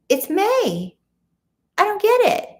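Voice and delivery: high, loud retold disbelief; mock bafflement. Diner retells seeing fall specials in May, high loud mock bafflement. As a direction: squeal it like a baffled tourist.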